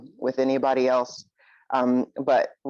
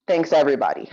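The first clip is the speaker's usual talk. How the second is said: ordinary talk, nothing marked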